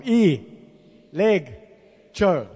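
"E, leg, cho" spoken as three separate syllables with a pause after each. Shown as a demonstration. Preacher drilling the congregation on the Greek word, pausing between "E", "leg" and "cho". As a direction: break "E, leg, cho" apart slowly for the class to repeat. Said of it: "I'll do it one more time".

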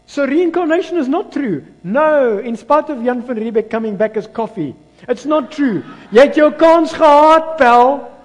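loud, high, impassioned preacher; thundering pulpit proclamation; full-force preaching. Real, not acted. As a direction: belt it out to the congregation, stretching key phrases.

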